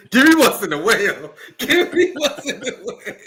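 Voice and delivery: laughing through the words, pitch climbing; giggling out the pick. Real, not acted. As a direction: crack up while you answer. Laughing at the drink name.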